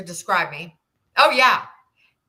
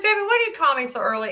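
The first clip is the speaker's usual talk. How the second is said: fast, higher and bewildered; fast and higher, a little bewildered; quick puzzled question; 8 semitones higher, faster than usual. Retold or imitated. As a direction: rush it out, baffled. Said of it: "I said".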